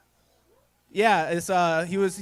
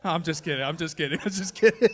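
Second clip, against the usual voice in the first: laughing. Real, not acted. Laughing repeats.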